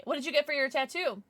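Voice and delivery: ordinary talk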